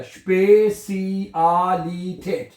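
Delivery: Spacey आ ली ठेठ very slowly, syllable by syllable; Spacey आ ली ठेठ as slow "shpay-see-aah-lee-tate"; broken into syllables. Shown as a demonstration. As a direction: sound out Spacey आ ली ठेठ, stretching every vowel. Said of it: "लफ्ज को तोड़ लें".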